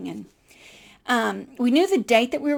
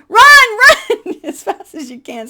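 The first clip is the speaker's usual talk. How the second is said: high, loud shout of run; laughing shout; 12 semitones higher, 15 dB louder, slower than usual. Retold or imitated. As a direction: yell it through laughter to hurry her. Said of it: "Europeans are shouting".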